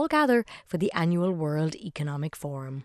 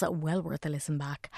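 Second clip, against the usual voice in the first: normal talking voice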